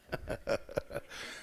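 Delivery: quiet airy chuckle; soft amused laugh; soft breathy laugh. Real, not acted. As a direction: let a soft laugh slip out. Laugh after the whoa.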